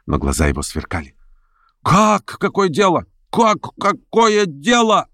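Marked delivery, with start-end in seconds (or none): 1.8-5.1 very high, drawn-out indignant cries; outraged exclamations. A narrator's reading. Meeting members react to Stavrogin, exclaiming in outrage. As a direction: echo it back in outrage. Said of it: "раздались восклицания".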